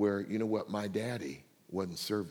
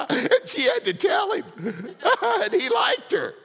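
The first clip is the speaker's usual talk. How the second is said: high, loud laughter through the words; laughing; 8 dB louder than usual. Real, not acted. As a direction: talk while laughing hard and high. Laughing over the story.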